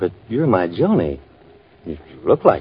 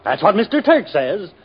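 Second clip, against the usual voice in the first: dream rant; 10 semitones higher than usual. Acted. Dreaming, greedy and pompous.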